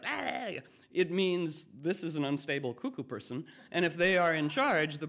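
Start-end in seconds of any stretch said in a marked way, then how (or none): 0.0-0.5 sudden high panicked "ah"; panicked yelp. Pretend. Unstable person in charge panicking, high yelp. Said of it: "if you are like".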